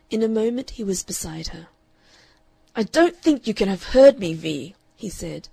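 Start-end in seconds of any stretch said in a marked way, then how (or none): 2.7-4.8 loud, clenched, barely held-in fury; controlled fury. A narrator's reading. Father warning daughter at the door, in controlled fury. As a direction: hold the fury just under the lid. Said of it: "with intensely controlled fury".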